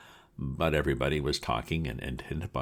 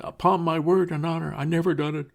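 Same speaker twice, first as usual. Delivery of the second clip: sobbing plea; 6 dB louder than usual. Acted. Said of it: "he sobbed".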